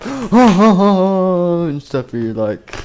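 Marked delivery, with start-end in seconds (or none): 0.0-1.6 gasp, then loud high drawn-out cackle; slow, triumphant mock laugh; gloating villain laugh. Victor gloats after enemy flees.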